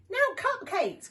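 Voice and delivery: high, eager call; excited cry. Acted. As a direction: cry out with delight. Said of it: "cried Shifty".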